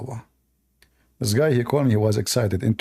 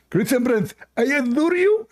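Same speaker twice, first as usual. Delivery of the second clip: high mocking caller voice, "I adore you" rising; mock Abdul voice; 10 semitones higher than usual. Mocking the caller Abdul, high put-on voice. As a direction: lampoon the caller, pitched high. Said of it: "Abdul, what?".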